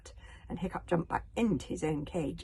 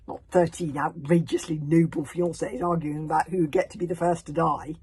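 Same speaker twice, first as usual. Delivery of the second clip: plain reading voice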